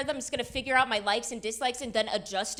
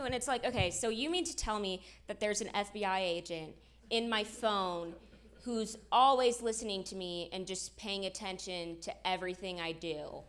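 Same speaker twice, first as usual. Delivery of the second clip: ordinary talk, nothing marked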